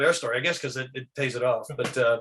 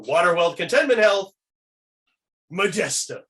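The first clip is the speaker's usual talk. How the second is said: louder, raised toasting voice; 4 semitones higher, 5 dB louder than usual. Shown as a demonstration. Raising a glass with buddies, loud proclaimed toast. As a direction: lift your glass and proclaim it. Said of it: "you grab your glass and you go".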